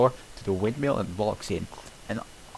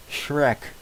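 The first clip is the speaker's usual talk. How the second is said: muffled shout; 6 semitones higher, 4 dB louder than usual. Acted.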